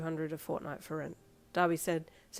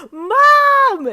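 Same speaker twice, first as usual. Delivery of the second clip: much higher, full loud scream; 21 dB louder than usual. Acted. Teen screaming for mother after acceptance. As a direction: scream it with joy. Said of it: "you screamed".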